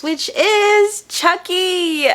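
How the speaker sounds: high, long drawn-out gleeful announcement; real fangirl excitement, slow and high; excited reveal. Real, not acted. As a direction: stretch it out like unveiling your favorite. Gleefully reveals her favorite sticker.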